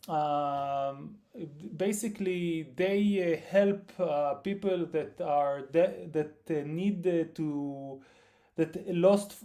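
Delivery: usual speaking voice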